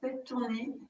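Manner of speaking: ordinary talk, nothing marked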